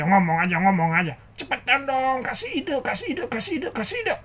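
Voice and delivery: loud, rising, hammed-up rant; exasperated puppet tantrum; angry impatient character.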